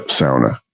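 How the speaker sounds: "sauna" said SOW-nuh; Finnish pronunciation. Shown as a demonstration. Confirming the Finnish word, "sauna" as SOW-nuh. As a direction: say "sauna" with "ow" as in cow. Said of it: "It's pronounced sauna".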